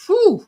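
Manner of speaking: high, loud whew of relief; relieved whew. Retold or imitated. Retelling relief that the characters survived, exaggerated whew. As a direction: blow out a big relieved whew. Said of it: "'cause I was like".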